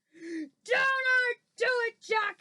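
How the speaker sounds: high, loud, drawn-out screech; screeched plea. Acted. Giant begs Jack in his nightmare, screeching. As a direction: screech it, begging him to stop. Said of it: "he screeched".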